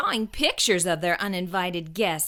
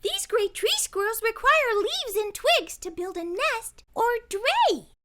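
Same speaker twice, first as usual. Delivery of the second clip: put-on high, knowing girl's voice; lightly voiced know-it-all girl; explaining; 13 semitones higher than usual. A narrator's reading. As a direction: explain it patiently. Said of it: "explained Bridget".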